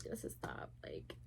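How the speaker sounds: whispered self-talk; hushed, slow inner voice; voice in her head. Retold or imitated. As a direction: whisper it to yourself, slowing on the last word. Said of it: "like, in my head".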